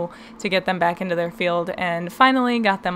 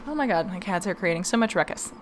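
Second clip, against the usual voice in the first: ordinary talk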